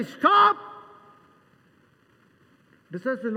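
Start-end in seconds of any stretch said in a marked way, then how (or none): 0.0-0.6 sudden high, loud shout. Acted. Jesus shouting to stop the crowd, sudden and loud. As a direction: halt everyone with one loud cry.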